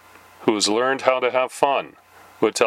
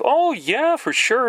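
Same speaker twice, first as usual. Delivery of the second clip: much higher, bright agreeable sales voice; eager telemarketer; 14 semitones higher, slower than usual. Retold or imitated. Telemarketer agreeing to a second card, high and eager. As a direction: play the delighted salesperson.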